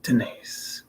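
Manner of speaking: soft unvoiced whisper. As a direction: breathe it out gently. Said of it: "I'm just trying to whisper".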